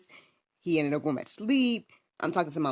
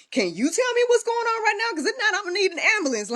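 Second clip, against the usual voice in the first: high, rushed, urgent pleading; frantic plea; 4 dB louder than usual. Retold or imitated. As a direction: beg the operator in a hurry, mock-polite. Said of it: "I said it the nicest way possible".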